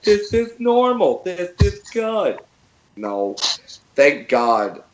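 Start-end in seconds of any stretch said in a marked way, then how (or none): 0.0-2.4 high, slow mock entitled voice; hammed-up entitled passenger; mock entitled woman. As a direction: play the clueless peacock owner, drawn out. Peacock woman claiming normal.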